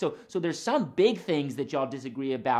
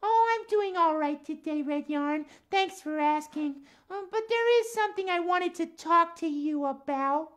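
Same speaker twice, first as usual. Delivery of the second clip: higher-pitched puppet voice, friendly; lilting cat character voice; Max the cat; 13 semitones higher than usual. Acted. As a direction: play a chatty cat puppet for children.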